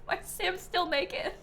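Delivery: normal talking voice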